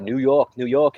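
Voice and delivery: demonstrating a New York accent on the city's name. Shown as a demonstration. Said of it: "New York accent".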